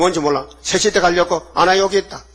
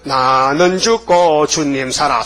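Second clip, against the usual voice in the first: slow solemn confession; 3 semitones lower, much slower than usual. Acted. Believer's confession quoted.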